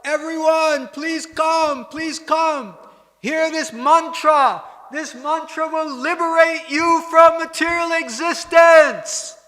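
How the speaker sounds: loud, high call from a temple roof; shouting out to all. Retold or imitated. Ramanuja calling villagers from the temple top, loud high shout. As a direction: shout it to the whole village below. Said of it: "and he shouted out".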